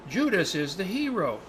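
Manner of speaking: slow, drawn-out mock admiration; retold awed conclusion. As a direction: draw it out like a great revelation. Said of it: "And they said".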